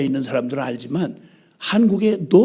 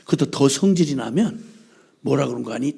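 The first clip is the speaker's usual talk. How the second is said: normal talking voice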